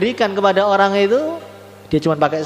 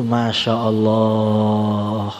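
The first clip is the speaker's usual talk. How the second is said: low, very long-drawn marvel; awed exclamation; 8 semitones lower, much slower than usual. Retold or imitated. Preacher marvels at the sleeper's dream, drawn-out awe. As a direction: stretch the last vowel out, almost sung.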